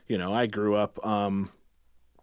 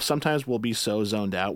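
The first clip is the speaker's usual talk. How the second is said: ordinary talk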